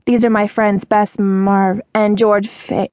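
slow, with "Marv" and "Fa-" cut short and blurred; drawn-out intro, "Marv" and "Fa-" smudged unfinished; slurred surnames. A narrator's reading. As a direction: slur "Marv" and "Fa-" so nobody can repeat them. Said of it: "she slurred the last name".

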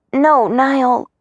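ordinary delivery

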